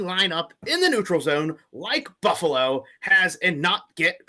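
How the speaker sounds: hammed-up furious rant, shouted high; over-the-top angry fan ranting at the refs; angry reading. Shown as a demonstration. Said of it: "read it from an angry point of view".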